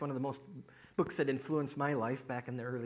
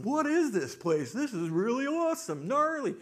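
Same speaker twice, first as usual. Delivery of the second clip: hammed-up amazement, stretching the words; awestruck ex-husband; 10 semitones higher, 5 dB louder than usual. Ex-husband wanders into heaven, loudly awestruck.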